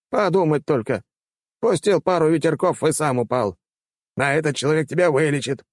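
high-pitched, exasperated rector; dismissive old rector. Acted. Rector dismissing a feeble wind mage, exasperated. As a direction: marvel at the pupil in a high old-man voice.

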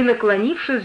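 ordinary delivery

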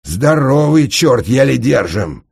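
loud, slow, high straining voice; strained wheeze. A narrator's reading. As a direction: force it out under heavy effort. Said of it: "просипел побагровевший от натуги будушник". (громко сипеть от натуги)

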